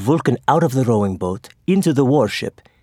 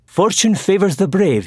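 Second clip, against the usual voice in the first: higher, declaiming a proverb; quoting a proverb; 7 semitones higher than usual. A narrator's reading. Admiral quoting a proverb to his men, grandly declaimed. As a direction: proclaim the saying grandly. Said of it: "he quoted".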